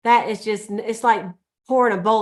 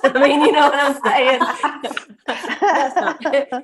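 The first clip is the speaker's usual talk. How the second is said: laughing through the words, higher and slower; 6 semitones higher, 5 dB louder, much slower than usual. Real, not acted. Laughing after the church mint story, laughing. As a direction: breaks into laughs while explaining.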